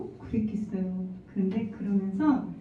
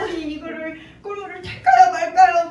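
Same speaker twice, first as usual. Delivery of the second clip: a touch higher, torn asking in prayer; wife asking God whether to make honey water, lightly fretting; retold fretting prayer; 8 semitones higher, 7 dB louder than usual. Wife praying to God, half-pleading, slightly higher. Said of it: "막 이렇게 하고 있었단 말이에요".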